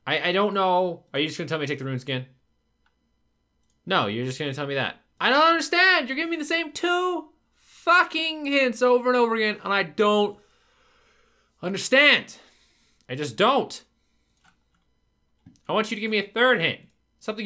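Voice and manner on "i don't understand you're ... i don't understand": higher, louder complaint; exasperated rant; frustrated rant. Real, not acted. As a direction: vent at the repeated hints. Ranting about repeated hints.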